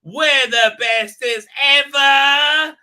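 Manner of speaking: drawn-out, high, loud smug boast; mock gloating. Mocking the characters as a smug loud boast. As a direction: ham up the smug self-praise.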